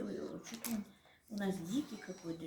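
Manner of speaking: usual speaking voice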